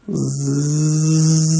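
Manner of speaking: mimicked plane buzz, soft; a faint approaching buzz; buzzing noise. Pastor imitates the second plane coming, soft buzz.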